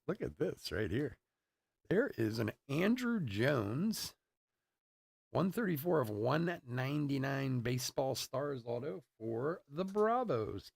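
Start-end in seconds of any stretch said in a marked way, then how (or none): 1.9-10.7 slow, stretched-out announcer call; higher, lingering showman's delivery; card reveal announcer. Acted. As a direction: present the card with drawn-out fanfare.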